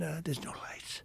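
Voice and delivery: retold hushed worry; hushed aside. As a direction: whisper it to the pilot. Acted nervous remark to the captain.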